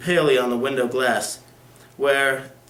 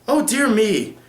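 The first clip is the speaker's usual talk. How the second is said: a mild mock protest; remonstrating; 7 semitones higher, 4 dB louder, slower than usual. A narrator's reading. Sir Leicester objecting to the lawyer, mild protest. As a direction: fuss a little, like an offended gentleman. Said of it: "remonstrates Sir Leicester".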